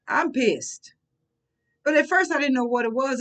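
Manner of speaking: ordinary talk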